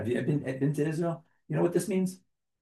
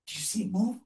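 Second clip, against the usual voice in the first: mock excited parent; 5 semitones higher, 6 dB quieter, faster than usual. Parent thrilling kids at Seder.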